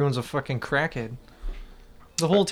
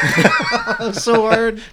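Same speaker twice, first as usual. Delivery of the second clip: higher, slow mock-strained delivery; slow, high teasing groan; 7 semitones higher, much slower than usual. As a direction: drag it out like it was painful. Mocking the hesitation, laughing.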